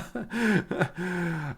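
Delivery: soft laugh dying away; real quiet chuckling fit. Real, not acted. Streamer reading chat's comments, laughing softly.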